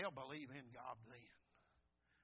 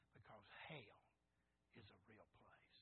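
very soft, low, grave hush; hushed solemnity; 6 semitones lower, 13 dB quieter than usual. Real, not acted. Preacher warning that hell is real, hushed solemnity. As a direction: drop to a near whisper.

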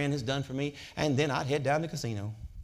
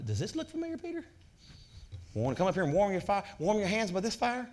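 a hint of a soft, high, knowing God; lightly voiced Lord, teasing softly; gentle teasing God; 6 semitones higher, 3 dB quieter than usual. God teasing Peter at the fire.

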